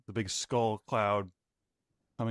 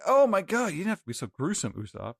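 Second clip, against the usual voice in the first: shocked outburst; 7 semitones higher, faster than usual. Retold or imitated. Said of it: "and like".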